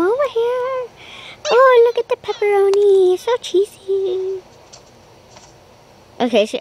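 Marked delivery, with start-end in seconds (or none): 0.0-4.4 pet talk voice. Acted. High voice talking to puppy.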